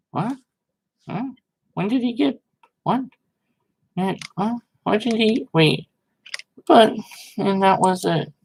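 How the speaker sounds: high, incredulous what; confused disbelief, pitch jumping up; baffled. Real, not acted. Baffled by the abrupt goodbye.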